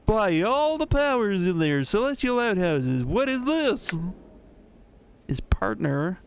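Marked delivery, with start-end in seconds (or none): 0.0-3.9 blustering little broker, higher; comedic accent. Acted. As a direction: play the little broker as a comic exclamation. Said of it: "His voice betrays a nearly comedic accent".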